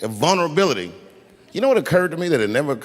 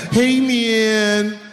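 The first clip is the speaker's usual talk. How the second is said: high, raspy junkie voice, pleading; strung-out woman; 8 semitones higher, much slower than usual. Retold or imitated. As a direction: beg like a sick addict, voice pitched up and slowed. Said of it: "She said".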